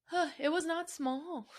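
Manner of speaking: real emotion welling up, words coming out slow; getting emotional. Real, not acted. Telling listeners about healing talk with estranged father, voice catching. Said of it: "sorry, I'm getting emotional".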